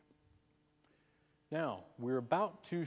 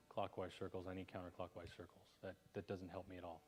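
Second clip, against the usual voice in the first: quiet dismissive aside; 5 semitones lower, 12 dB quieter than usual. Acted. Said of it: "For example".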